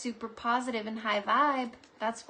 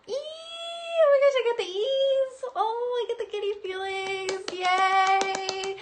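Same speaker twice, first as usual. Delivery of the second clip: much higher, loud, sing-song squeal; giddy squeal; 10 semitones higher, 6 dB louder, slower than usual. Real, not acted.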